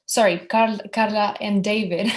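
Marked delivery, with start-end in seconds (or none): none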